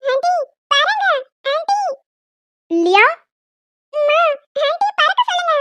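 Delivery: put-on high, pleading kid's whine; whining child. Little girl nagging mother to make aunt fly. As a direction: nag the aunt and mum.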